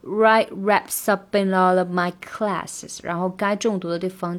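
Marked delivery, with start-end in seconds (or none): 0.0-3.0 very slow, linked write raps up in all of my classes; very slow drill. Shown as a demonstration. Teacher slowly drills the linking. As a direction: crawl through every link. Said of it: "要把它连读练清楚".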